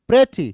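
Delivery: "pretty" with high, loud pre and dropped ty; stress on PRE. Shown as a demonstration. Teacher confirms it for students, first syllable of "pretty" stressed. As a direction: hit pre hard in "pretty". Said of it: "पहला syllable stressed है".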